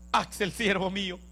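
voz divina más grave y suave, al oído; voz de Dios al oído. Retold or imitated. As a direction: susurra como Dios al oído del siervo. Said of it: "una voz que me habla al oído y me dice". (Dios le habla a Axel al oído, grave y suave)

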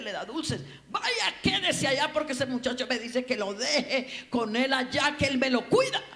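loud, high mock tirade of an exasperated mother; imitated scolding mother. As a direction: scold the child at full volume, building to a yell. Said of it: "Pero de esas mamás".

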